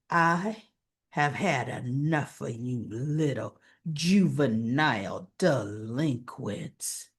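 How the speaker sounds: low, slow threatening voice; hammed-up low villain menace; menacing villain. Drew threatening the boys.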